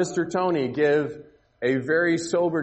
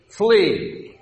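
a sharp, raised start call; loud command; 5 semitones higher, 5 dB louder than usual. Real, not acted. Teacher signalling the volunteers to run, loud command. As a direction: bark flee as the starting signal.

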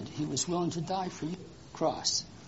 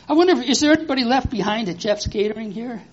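excited wondering; 7 semitones higher, 10 dB louder than usual. Real, not acted. Excited reveal for children.

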